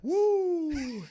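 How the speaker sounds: high, loud, drawn-out whoop; loud high "woo"; hype man whoop. Acted. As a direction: let out a long stage whoop. Hype man whoop amid joking.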